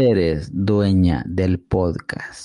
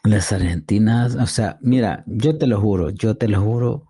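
ordinary talk, nothing marked

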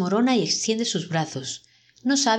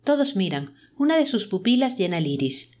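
plain reading voice

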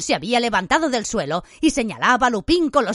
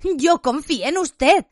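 high, slow cry of betrayal; hurt, angry accusation pitched up; faltering shout; 8 semitones higher, 3 dB louder, slower than usual. A narrator's reading. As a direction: shout it with the voice giving way. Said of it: "gritó a Lupin flaqueándole la voz".

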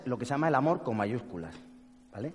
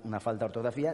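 ordinary talk

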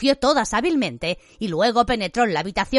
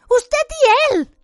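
much higher, louder, slow; hysterical accusation; 6 dB louder, much slower than usual. A narrator's reading. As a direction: cry it out, pointing at both.

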